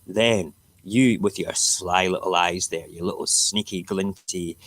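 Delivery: ordinary delivery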